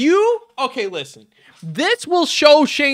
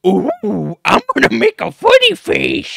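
mock a goofy performer in a raised voice; mocking silly impression; 3 semitones higher than usual. Continues mocking impression of the YouTuber.

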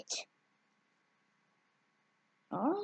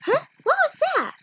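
sudden alarmed high cry; startled; 11 semitones higher, 4 dB louder, much faster than usual. Acted. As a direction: jump at a noise, loud and high.

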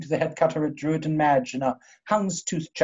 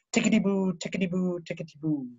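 fading repetition; 6 dB quieter than usual. Acted. Reverie fading out.

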